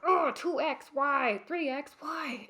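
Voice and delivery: hammed-up despair over a clothing size; mock dismay. Imagined shopper lamenting the tag, mock dismay.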